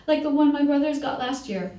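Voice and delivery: high, loud eager outburst; bursting out. A narrator's reading. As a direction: let it spill out before he can stop. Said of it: "he burst out".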